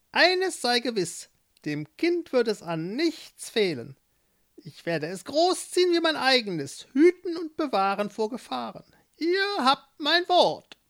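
put-on high, sly old witch. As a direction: play the witch, curious and pleased. Witch voiced in story.

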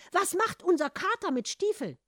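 high, loud, baffled question; incredulous. A narrator's reading. Family questions Hans about the cat, incredulous. Family quarrel over the boots.

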